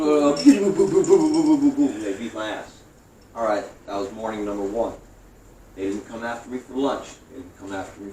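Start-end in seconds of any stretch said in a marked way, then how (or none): 0.0-2.0 mock punch sounds. Mimicking getting beaten up.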